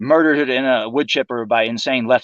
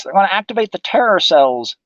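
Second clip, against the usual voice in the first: mock alarm; 8 semitones higher, 4 dB louder than usual. Mocking official terror warnings.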